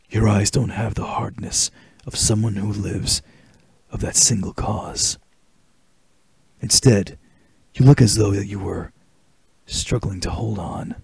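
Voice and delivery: low, hushed game-hero voice; soft, deep soldier's monologue; Solid Snake. Acted. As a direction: deliver a grave speech low and quiet, with measured pauses. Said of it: "What a big speech from Solid Snake".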